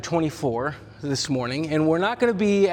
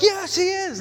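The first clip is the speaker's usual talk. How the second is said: much higher, hammed-up joy; mock excited. Disciples in a pretend cheer he then takes back, much higher mock joy. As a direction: play the cheer up as a fake-out. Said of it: "the disciples get so excited".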